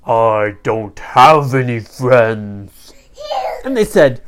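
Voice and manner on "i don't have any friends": low, loud, slow villain; menacing storybook villain, drawn out; un-fairylike villain voice. A narrator's reading. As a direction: growl it slowly as the evil little creature. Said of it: "A fairy doesn't sound like that".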